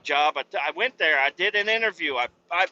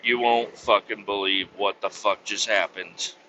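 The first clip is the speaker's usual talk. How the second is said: ordinary delivery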